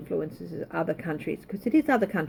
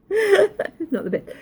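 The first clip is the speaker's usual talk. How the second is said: much higher, giggling through the words; real giggle while admitting a slip; laughing at herself; 13 semitones higher, 7 dB louder, slower than usual. Real, not acted. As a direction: laugh it off, embarrassed at the mistake. Laughing at own numeral mistake.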